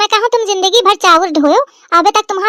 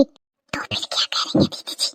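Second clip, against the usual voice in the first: hushed whisper, slightly slow; voiceless conspiratorial whisper; 11 dB quieter than usual. Acted. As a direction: whisper so the mother-in-law can't hear. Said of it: "इतना धीरे न बोल".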